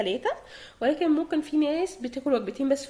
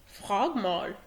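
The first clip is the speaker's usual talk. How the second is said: voicing Max; 5 semitones lower, 5 dB quieter than usual. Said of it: "حاولت اقلد لكم صوت ماكس".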